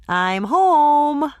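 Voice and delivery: high, loud call home. Acted. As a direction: call into the flat. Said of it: "Hannah announced".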